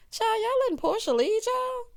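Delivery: high-pitched incredulous squeal; high, amused disbelief. Real, not acted. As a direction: voice shoots way up in disbelief. Mock disbelief at what she recounts.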